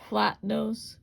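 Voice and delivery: normal talking voice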